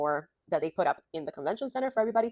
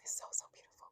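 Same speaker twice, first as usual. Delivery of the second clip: hushed whisper, much softer; awed whisper; 16 dB quieter, faster than usual. Real, not acted. Vlogger admiring her own stitching, whispered in awe. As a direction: breathe it out in awe over the stitches.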